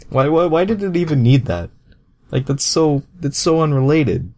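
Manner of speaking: high, loud, fast disbelieving rant; squeaky outraged complaint; exasperated. Real, not acted. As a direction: throw your hands up at how pointless it is.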